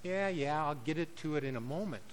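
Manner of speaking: a lazy yeah-yeah brush-off from the desk; husband half-listening as his wife asks, brushing it off. Retold or imitated. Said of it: "I would go".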